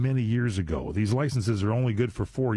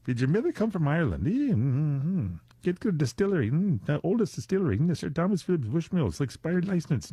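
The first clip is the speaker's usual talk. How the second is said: mock Irish accent; 5 semitones higher than usual.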